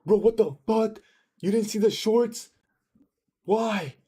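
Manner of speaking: high whiny mock of an angry viewer; mocking angry chatter. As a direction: parody the raging chatter in a high, nasal-free squeaky voice. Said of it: "He's like".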